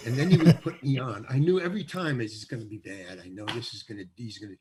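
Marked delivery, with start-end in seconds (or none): 2.2-4.5 much lower and softer inner dread; low, soft, dreading aside to himself. Retold or imitated. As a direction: mutter the worry under your breath.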